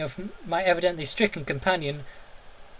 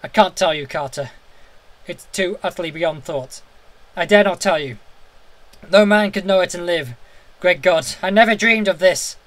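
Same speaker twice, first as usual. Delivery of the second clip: loud, fearful and despairing; voice full of fear; 4 semitones higher, 11 dB louder than usual. A narrator's reading. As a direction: tell him through rising dread. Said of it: "still hoarse with fear".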